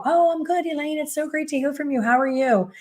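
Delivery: overly sweet client. Retold or imitated. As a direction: gush to cover the awkwardness. Client answers the stylist's call.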